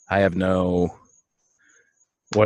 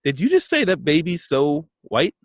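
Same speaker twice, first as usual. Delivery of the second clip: surprised; 7 semitones higher than usual. Retold or imitated. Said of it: "I'm like".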